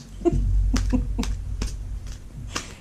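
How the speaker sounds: breathy embarrassed giggle, unvoiced; a shy giggle at her own joke. Real, not acted. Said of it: "And giggle".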